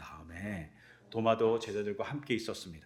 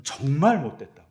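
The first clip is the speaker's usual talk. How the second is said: angry reproach; 4 semitones higher, 9 dB louder than usual. Pretend. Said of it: "원망의 말씀을 하셨을까요".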